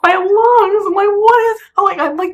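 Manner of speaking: high, loud wail of alarm; panicked near-crying. Retold or imitated. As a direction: act out a frantic, high-pitched outburst. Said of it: "crying at this point".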